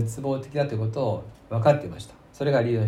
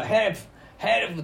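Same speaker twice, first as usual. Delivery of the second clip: high, quick demonstrated call for help; mock cry for help; 6 semitones higher, faster than usual. Shown as a demonstration. Disaster victim calling to approaching rescuers, mock high cry for help. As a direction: imitate a shout for rescue without full volume. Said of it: "って叫ぶような".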